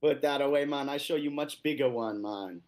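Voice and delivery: put-on playful Jamaican accent. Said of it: "I like your Jamaican accent".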